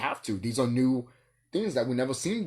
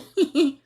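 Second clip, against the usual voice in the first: a real high cackle, louder; high loud burst of laughter; 9 dB louder than usual. Real, not acted. Vlogger cracking up at her own joke, loud high laugh.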